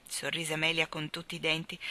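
ordinary delivery, nothing marked

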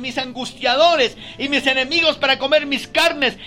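preacher declaring the psalm, slow and loud; scripture reading at full force. Acted. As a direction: proclaim each verse slowly to the church. Reads psalm 27 aloud.

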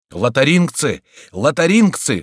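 cry from the crowd. A narrator's reading. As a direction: yell the warning across the crowd. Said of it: "вскричал голос из толпы".

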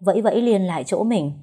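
usual speaking voice

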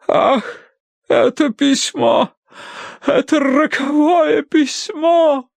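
high, slow swooning lament; melodramatic wail. A narrator's reading. Mother wails at the fateful letter before fainting, high and slow. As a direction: draw it out in hammed-up despair.

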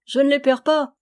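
animated retort without real laughter; amused retort. A narrator's reading. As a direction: answer back in good humour. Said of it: "répondit le jeune tireur en riant".

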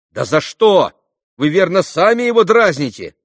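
high, loud reproach; shouted objection. Acted. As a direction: argue back over the shouting. Alyosha argues back amid shouting. (громкое возражение)

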